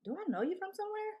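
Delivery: mock curious man, high and quick; lightly voiced puzzled guy, high; imitating him. Man asking if they've met.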